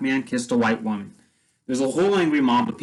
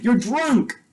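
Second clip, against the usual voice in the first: indignant retort; 10 semitones higher than usual. Retold or imitated. Teacher rebuffs drunk sheriff.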